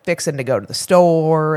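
put-on thick Tennessee-style Southern accent. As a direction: mimic a very Southern small-town roommate. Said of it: "super, super Southern".

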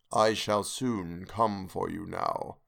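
low, slow, distant ghostly voice; ghostly face. Acted. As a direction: drag out each word like a phantom. Ghostly face speaks in nightmare.

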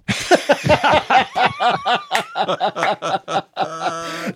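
long, high-pitched burst of laughter; big real laugh. Real, not acted. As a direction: crack up helplessly at the punchline. Cracking up at his own punchline.

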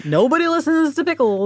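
high, put-on whine of complaint; aggrieved dog. Dog complains to owner who never listens, high mock grievance. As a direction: raise the voice high, a mock-wounded grumble.

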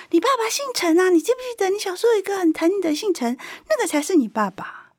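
very high, insistent uncle imitation; neighbourhood uncle. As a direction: spill the secret like a gossipy local elder. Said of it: "就我们那附近的阿伯告诉我".

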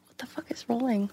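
hushed confused whisper; whispered disbelief. Retold or imitated. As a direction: whisper it, baffled. Retold confused reply.

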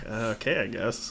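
soft, low, unconvinced agreement; reluctant consent. Acted. Manager giving in to a musician's odd request, quiet and reluctant. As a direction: give in under your breath.

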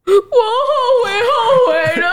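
put-on high, slow, sobbing kid; crying child. Toddler sobs to mom, high and slow. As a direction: wail it out slowly like a crying toddler.

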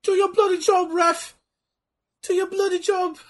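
high, squeaky British voice; British heckler. Pretend. Wrestling fan heckles the ref over his bloody job, high-pitched British heckler. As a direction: heckle in a falsetto British accent.

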